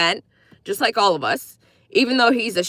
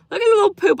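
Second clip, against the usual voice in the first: cooing; 9 semitones higher, 4 dB louder, much faster than usual. Real, not acted. Cooing at chat emoji.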